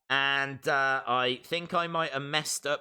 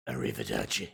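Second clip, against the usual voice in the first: deep voice sign-off; 4 dB quieter, faster than usual. Pretend. Signing off in character.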